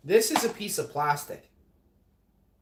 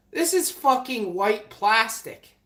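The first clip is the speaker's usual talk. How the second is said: angry; 7 semitones higher, 5 dB louder, slower than usual. Real, not acted. Outraged about plastic in smoothie.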